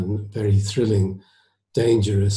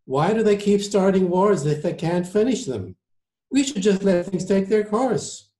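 higher-pitched, exasperated diner; argumentative surgeon; 10 semitones higher than usual. Acted. Surgeon argues against joining the war, raised pitch. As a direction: argue the case as an isolationist.

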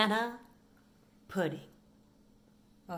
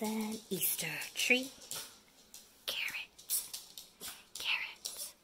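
whispering; 3 semitones lower, 8 dB quieter than usual. Real, not acted. Whispering to dogs.

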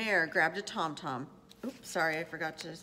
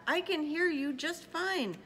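raised, higher reply; calling back; 7 semitones higher than usual. A narrator's reading. Horton answering the mayor, called reply. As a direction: call it back up brightly pitched. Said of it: "Horton called back".

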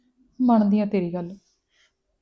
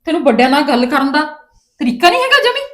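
raised, higher scolding of a daughter; angry scolding; 5 semitones higher, 4 dB louder than usual. Acted. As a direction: demand an answer from her, sharp and loud. Mother scolds daughter's rudeness. (ਗੁੱਸੇ ਵਿੱਚ ਉੱਚੀ ਝਿੜਕ)

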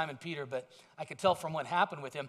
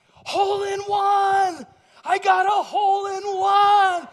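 very high, very loud, slow joyful scream; ecstatic screaming; 13 semitones higher, 13 dB louder, slower than usual. Retold or imitated. Golfer after a hole in one, ecstatic scream. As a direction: scream it like the win of your life. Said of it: "He was so ecstatic, screaming".

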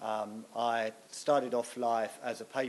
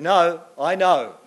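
slow, much louder mock certainty; insistent old lady; 5 semitones higher, 13 dB louder, slower than usual. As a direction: drag it out like she won't be told. Lady insists on her guess.

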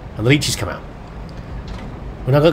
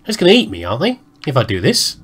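ordinary talk, nothing marked

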